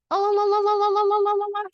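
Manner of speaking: high, loud warbling la la la; hawk owl call. Shown as a demonstration. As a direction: sing the owl's rolling call way up high. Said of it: "So the hawk owl says".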